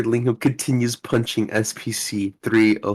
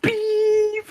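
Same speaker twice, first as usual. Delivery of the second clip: high beep. Acted. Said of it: "I'll be Doctor Lingham".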